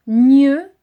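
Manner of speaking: "Gneux" punched very loud with a rising end; stressed syllable. Shown as a demonstration. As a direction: hit "Gneux" hard as a dictation syllable. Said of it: "il faut se souvenir". ("Gneux" martelé très fort)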